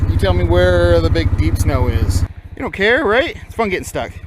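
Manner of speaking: panting between words; winded, catching your breath. Real, not acted. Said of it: "if I'm sounding like I'm out of breath".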